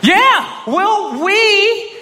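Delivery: very high, drawn-out stalling voice; high, slow, improvised false confidence; bluffing agreement. Retold or imitated. Bluffing that a crew exists.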